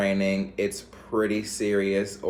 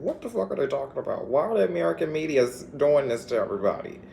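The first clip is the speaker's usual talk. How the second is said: mock outraged; 6 semitones higher, faster than usual. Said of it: "called me screaming".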